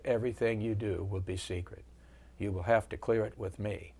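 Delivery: a hint of a soft-spoken Virginia general; soft Virginia accent. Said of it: "he had that soft Virginia accent".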